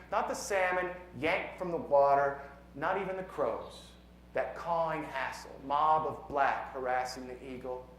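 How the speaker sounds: measured, emphatic poem reading; poetry recitation. Acted. Poet reads a science poem to an audience, measured recitation. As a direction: recite it with weight on key words.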